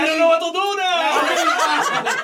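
slow, high, laughing mock of a flustered friend; mocking nervous friend. Teasing his flustered friend, slow laughing mock voice. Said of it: "You're like".

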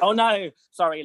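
high, laughing retold apology; flustered apology. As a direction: backpedal with an embarrassed laugh. Retold flustered apology after copying accent.

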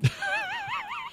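high wailing cry-laugh; mock wail. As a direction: wail like a sulking child. Mock wail about going back.

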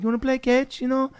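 high, soft kid with attempted Israeli accent; bad Israeli accent. Neighbor kid asking to play, poor Israeli accent. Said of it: "I can't do an Israeli accent".

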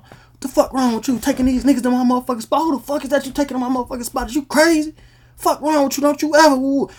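imitating an angry uncle yelling down the line; angry uncle on the phone. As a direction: rant at her through the phone.

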